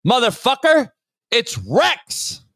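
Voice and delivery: ordinary talk, nothing marked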